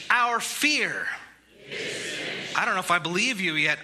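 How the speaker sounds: preacher calling out each thing defeated for response; rising call-and-response. Real, not acted. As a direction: call each one out to the congregation. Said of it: "I'm gonna say what Jesus defeated".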